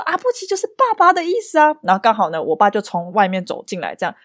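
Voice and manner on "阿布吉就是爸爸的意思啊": proud grandma. Retold or imitated. Grandma explaining word.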